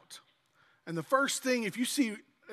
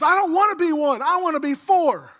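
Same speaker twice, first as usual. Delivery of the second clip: put-on much higher, louder defiant little girl; little girl's protest; 7 semitones higher, 10 dB louder than usual. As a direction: blurt it out like a stubborn little kid. Little girl speaks up, retold.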